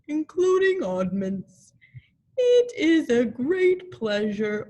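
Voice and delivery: a gloomy donkey making a very important speech; Eeyore's pompous speech. A narrator's reading. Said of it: "Eeyore coughed in an impressive way and began to speak".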